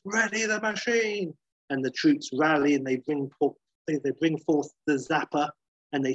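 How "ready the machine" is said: high mock scream, no real volume; screamed order. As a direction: imitate a furious commander's cry, pitched way up. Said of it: "and he screams".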